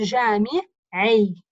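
جامعي split slowly, "jaami... ii" with the ʿayn starting the last part; جامعي with its last syllable set apart to show the i; kasra before final yāʾ. Shown as a demonstration. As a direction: say جامعي slowly, holding back the final "ʿii". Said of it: "الكسرة أنا حطيتها".

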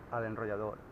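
ordinary talk, nothing marked

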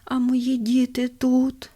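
put-on weak dying old man. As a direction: draw it out like a dying father. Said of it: "слабким голосом запитує".

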